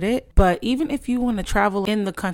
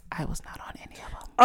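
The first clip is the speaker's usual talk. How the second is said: breathy, pitiful whispered voice; helpless whisper. Pretend. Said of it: "I have to put on my, like, helpless voice".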